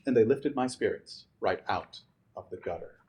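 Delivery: ordinary delivery, nothing marked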